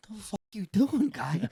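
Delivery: high mock friend. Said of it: "He goes".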